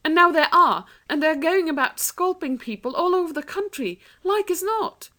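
put-on alarmed girl. A narrator's reading. Anthea tells Jane the awful truth, alarmed. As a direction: tell your sister in alarm.

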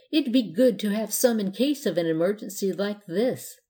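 plain reading voice

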